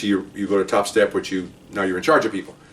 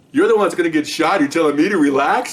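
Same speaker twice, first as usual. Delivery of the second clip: incredulous retort; 7 semitones higher, 4 dB louder than usual. Retold or imitated.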